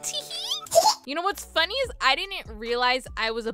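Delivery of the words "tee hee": high, dainty mock giggle. Mocking teammates who turned sweet, high coy titter. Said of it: "And now they're like".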